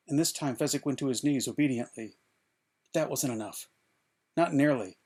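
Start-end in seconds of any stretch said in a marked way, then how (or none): none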